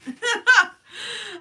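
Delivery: high loud burst of laughter; helpless laughter. Real, not acted. Streamer cracking up at the accent joke, high laughing fit. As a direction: break up laughing.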